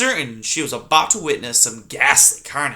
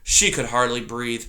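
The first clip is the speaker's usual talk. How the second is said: ordinary delivery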